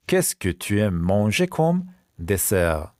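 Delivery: slow question, pause before "dessert"; slow repeat. Shown as a demonstration. Teacher repeats the dessert question for pupils, slowly. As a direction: space it out for learners.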